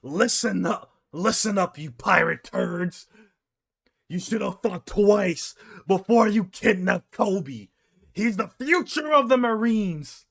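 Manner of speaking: high, slow gruff warning; mock heroic admiral scolding pirates; Garp threat. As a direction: lay down the law to the pirates. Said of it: "he tells them here".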